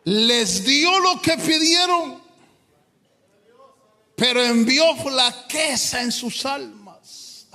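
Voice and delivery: high, slow shouted prayer; impassioned preaching. Acted. Preacher shouting his prayer to the Father, slow and high. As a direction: cry out for spiritual strength.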